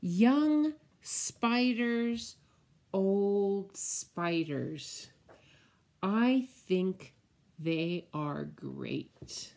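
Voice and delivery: slow, sing-song picture book read; reading to children. Acted. Teacher reading the spider book to children, slow and sing-song. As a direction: savour each spider word for little ones.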